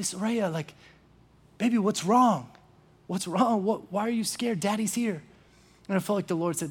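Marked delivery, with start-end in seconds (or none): 0.0-5.2 put-on soft, gentle father soothing his child; tender comforting. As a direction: hush and reassure a frightened little girl. Father comforting scared daughter.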